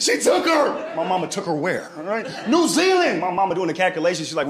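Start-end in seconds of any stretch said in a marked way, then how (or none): 0.0-0.9 high, loud panicked wail; frantic uncle. Retold or imitated. Distraught uncle telling his sister, high panicked wail. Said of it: "my uncle losing his shit". 2.5-3.2 high, loud panicked wail; frantic uncle. Retold or imitated. Distraught uncle answering his sister, high panicked wail. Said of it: "my uncle losing his shit".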